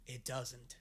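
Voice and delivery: very soft, low acted reply; hushed aside. Imagined villain chat about Hordak's baby, hushed and low. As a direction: drop it right down, barely voiced.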